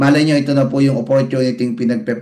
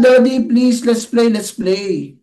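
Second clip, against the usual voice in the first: put-on pleading child; 6 semitones higher, slower than usual.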